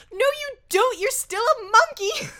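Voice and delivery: loud, high-pitched imitated scolding; mock exasperated yell. Villain correcting her clueless monkey, hammed-up exasperation. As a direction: shout it down like an exasperated cartoon villain.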